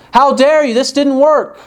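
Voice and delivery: hammed-up angry customer; indignant outburst. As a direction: act out her fury at the diet company. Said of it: "in her fury".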